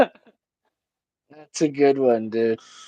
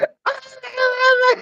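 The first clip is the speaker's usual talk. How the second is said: very high wailing scream; mock toddler scream. Nephew replaying what papa yelled, high screaming. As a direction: imitate a small child bursting into screams. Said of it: "he just started screaming".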